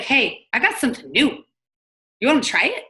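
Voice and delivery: excited. Shown as a demonstration. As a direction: sell it with bouncy enthusiasm. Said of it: "Be excited".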